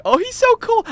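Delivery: high, loud starry-eyed gush; squealing fan of the supreme leader; gushing devotee. Pretend. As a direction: swoon like a true believer. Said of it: "And be like".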